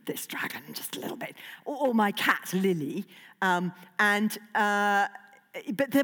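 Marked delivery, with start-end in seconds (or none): 0.1-1.3 whispered aside. Real, not acted. Author whispering an aside to the children.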